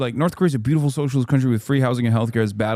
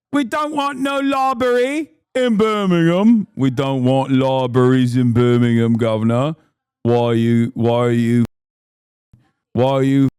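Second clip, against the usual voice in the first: mock a furious Birmingham local in a Brummie accent; exaggerated Brummie accent, telling off the governor; 3 dB louder than usual. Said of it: "Look up the Broomy accent".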